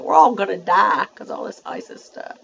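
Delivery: imitating a friend's Southern drawl. Retelling a funny coworker's line, southern drawl. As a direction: quote the coworker in a mock Southern accent. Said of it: "the way she said it with her southern drawl".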